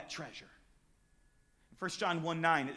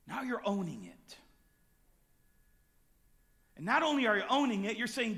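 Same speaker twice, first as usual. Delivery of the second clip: ordinary talk, nothing marked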